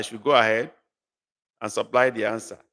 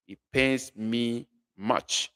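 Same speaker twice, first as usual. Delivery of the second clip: slow clear translation; 3 dB quieter, slower than usual. Shown as a demonstration. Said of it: "That is another word for it".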